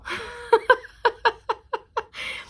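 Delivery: a high, bright burst of real laughter; big laugh. Real, not acted. Tarot reader laughing at her own reading, real high laugh. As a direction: laugh out loud, caught off guard.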